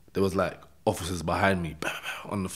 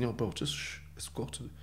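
hushed, trailing into a whisper; acted hushing; 11 dB quieter than usual. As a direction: quietly shush a mate as you retell it. Hushing a noisy mate.

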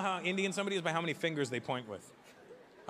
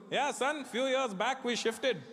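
put-on uncle's Indian accent; 6 semitones higher, slower than usual. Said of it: "He goes".

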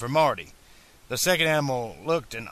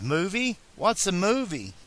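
lightly voiced boy, higher pitch; slightly raised, questioning child character; puzzled boy; 5 semitones higher than usual. A narrator's reading. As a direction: ask it as a baffled boy, pitched up. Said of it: "The boy frowned".

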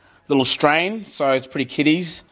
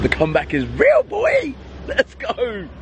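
hyped; 9 semitones higher than usual. Real, not acted. Footballer hyping his return to training, high and excited. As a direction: shout the comeback.

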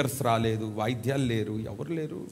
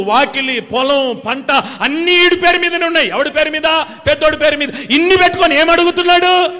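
high and loud, rising to a shout; fiery preaching; 10 semitones higher, 9 dB louder than usual. Real, not acted. Preacher rebuking small asking, rising to a shout. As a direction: hammer each item, climbing higher.

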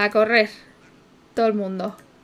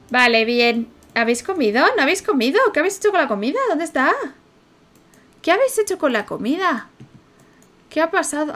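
louder, higher, exasperated questioning; mock scolding; 4 semitones higher, 5 dB louder than usual. A parent scolding Sims about missing food, loud. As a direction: demand to know where the food went.

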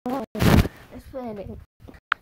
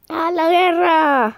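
battle cry; 5 semitones higher, 7 dB louder, much slower than usual. Acted. Rallying cry in game.

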